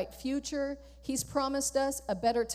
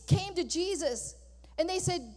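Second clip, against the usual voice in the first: ordinary talk, nothing marked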